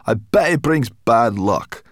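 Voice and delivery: slow, loud grumbling brownie; gruff brownie voice. Acted.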